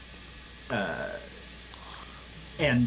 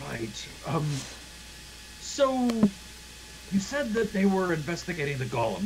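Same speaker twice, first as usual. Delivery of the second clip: put-on high, musing old man's voice; absorbed old scientist; 7 semitones higher than usual. Old man turns to the golem, absorbed and high-pitched. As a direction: leap up high on "so", then carry on. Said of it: "he walks over and he goes".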